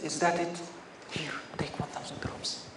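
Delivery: hushed, breathy offer; soft whisper. Acted. The imam quietly offering the man the thousand dirhams, whispered. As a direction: whisper it gently, unbothered. Imam answers the paid slanderer calmly.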